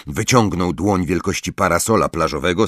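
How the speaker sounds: plain reading voice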